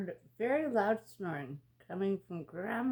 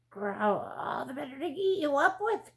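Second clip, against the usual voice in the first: loud, higher, menacing growl; 5 semitones higher, 5 dB louder than usual. A narrator's reading. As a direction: snarl it like a hungry wolf. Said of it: "growled the wolf".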